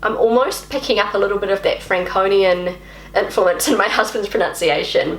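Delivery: ordinary talk, nothing marked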